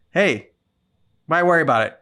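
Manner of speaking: usual speaking voice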